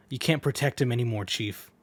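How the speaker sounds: ordinary talk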